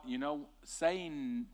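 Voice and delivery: normal talking voice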